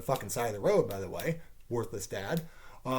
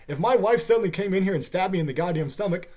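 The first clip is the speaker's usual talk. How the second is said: normal talking voice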